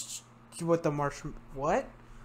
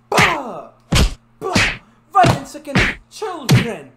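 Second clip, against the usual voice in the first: loud hammed-up war yells; mock battle cry; 9 semitones higher, 10 dB louder than usual. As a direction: yell it like charging into battle. Mock attack on small child.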